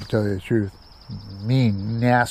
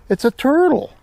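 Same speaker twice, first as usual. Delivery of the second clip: put-on sudden higher exclaim of a startled boy; higher startled kid realizing what he stepped on; surprised discovery; 12 semitones higher than usual. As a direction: blurt it out as the surprise hits. Kid discovering turtle under foot.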